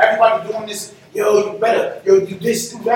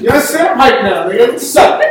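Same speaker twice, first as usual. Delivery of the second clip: ordinary talk, nothing marked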